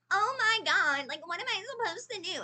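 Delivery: imitating a male narrator's high, nasal, stuck-up girl voice; mock girl voice. Reviewer mocking an audiobook narrator's girl voice, high and nasal. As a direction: squeak it out high and nasal like a snobby teen girl. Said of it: "his voice was, like, way too high".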